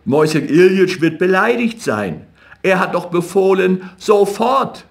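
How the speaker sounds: plead, climbing to a squeak on the last word; excited pleading. A narrator's reading. Rothschild running ahead of Jakob, pleading, excited and rising. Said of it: "aufgeregt und lief vor ihm her".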